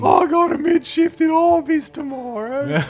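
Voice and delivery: put-on high, loud, slow Australian accent; mock Australian gamer with a laugh. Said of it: "Australian sounding people".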